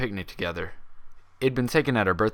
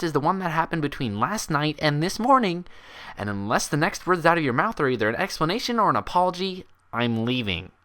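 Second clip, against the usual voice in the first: angry Twilight; 8 semitones higher than usual. Acted. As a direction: build her fury until she threatens to leave. She snapped.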